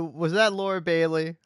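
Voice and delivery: ordinary talk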